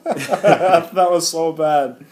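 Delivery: slow, loud laugh through words. Real, not acted. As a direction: laugh it out slowly. Laughing at failed player signing.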